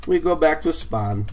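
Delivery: playful put-on game talk; odd put-on voice. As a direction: say it in a silly affected way. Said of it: "why I'm talking like that".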